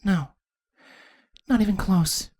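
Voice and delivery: high, soft princess voice; small, hopeless reply; grim. A narrator's reading. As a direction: answer grimly and quietly. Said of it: "a grim look on her face".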